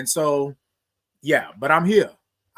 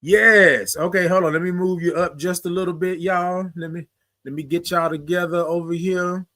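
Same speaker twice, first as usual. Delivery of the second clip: usual speaking voice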